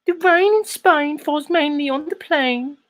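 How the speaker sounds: very high, hammed-up female English accent; squeaky My Fair Lady parody, English accent; mock English wife. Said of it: "then his wife's like".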